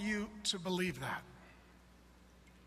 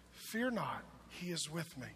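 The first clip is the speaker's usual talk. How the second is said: soft comfort; 5 semitones lower, 8 dB quieter than usual. Acted. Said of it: "soft in grief passage".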